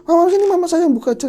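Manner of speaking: calling out high and loud across the room; urgent call. Retold or imitated. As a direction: shout to stop her mother opening it. Calling mother to let her open.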